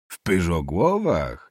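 slow, drawn-out questioning repeat; disdainful echo. Acted. Nobleman echoing the boy's village, slowly disdainful. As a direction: stretch the place name in disbelief.

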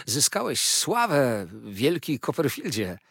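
excited, stretched-out admiration; admiring exclamation. A narrator's reading. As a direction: proclaim the friend's fame with delight. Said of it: "wołał Treadles".

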